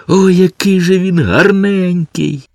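high, drawn-together coo of admiration; gushing cry. A narrator's reading. Girl rushing to the puppy, crying out adoringly. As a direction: cry out adoringly, pitched well up. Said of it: "кинулася до Бімбо і закричала".

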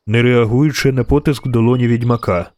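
usual speaking voice

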